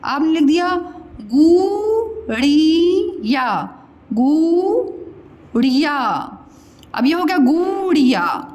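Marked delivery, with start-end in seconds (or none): 1.3-6.0 गुड़िया very slowly, syllable by syllable; गुड़िया with long oo and long ee; wrong long vowels. Shown as a demonstration. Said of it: "मान लीजिए मैं अशुद्ध लिख". 7.4-8.5 गुड़िया with a drawn-out "gooo"; गुड़िया said as "gooo-riyaa"; wrong long vowel. Shown as a demonstration. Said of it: "गुड़िया तो नहीं बोलते".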